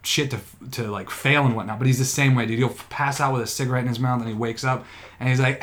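ordinary talk

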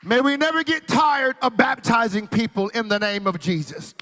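high, loud rallying prayer; full-force preaching. Real, not acted. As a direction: lift it into a rousing cry. Preacher swells again.

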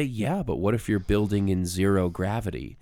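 usual speaking voice

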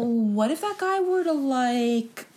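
drawn-out, hesitant question; a slow, hesitant plea put to the actress; slow halting speaker. Retold or imitated. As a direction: stall over every word like a nervous suitor. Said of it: "Very slow speaker".

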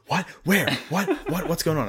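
rapid startled questions, imitating a coworker; retold freaking out. Coworker hears of the trailer, imitated freaking out. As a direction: fire off confused little whats, mock-frantic. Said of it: "And he's freaking out".

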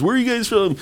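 high-pitched mock announcer calling to the crowd; imitated announcer. Announcer asking tourists in Cancun, high mock hype voice. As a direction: play a holiday host working a group. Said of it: "how they had the announcers".

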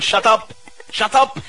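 high, sharp telling-off; mock yell. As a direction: snap it high at the noisy birds. Mock yelling at cheeping birds.

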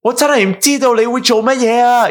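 high, hammed-up movie character; acted film line. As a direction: re-enact the comic scene, pitched well up. Acts out the rooftop film scene.

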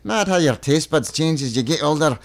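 lilting Scottish voice; Scottish accent. Acted. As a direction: read it as a musing Scot. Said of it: "This is the best accent work".

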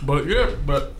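talking while crunching ice; words around a mouthful of ice; chewing ice. Real, not acted. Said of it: "I'm chewing ice".

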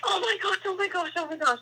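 high, breathless-with-thrill chant; high, rapid excited repetition; retold excitement. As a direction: re-enact being giddy, keeping it hushed. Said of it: "like, so excited".

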